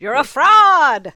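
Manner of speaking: slow, loud playful accusation; mock accusation. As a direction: drag it out like a mock verdict. Teasing accusation.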